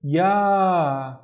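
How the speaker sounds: YA with the a stretched long; drawn-out "ya"; long vowel. Shown as a demonstration. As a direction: hold "yaa" out slowly. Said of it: "it has the alif".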